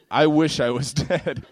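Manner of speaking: ordinary delivery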